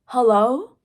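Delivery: loud disbelieving call-out; can you believe this; incredulous. Real, not acted. Incredulous aside to audience.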